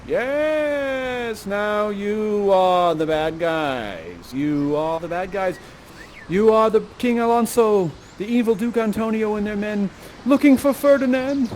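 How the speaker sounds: drawn-out, grand storyteller voice rising in pitch; theatrical scene-setting. Acted. Storyteller casts audience as the bad guys, grand drawn-out announcing. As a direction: announce their roles slowly and grandly.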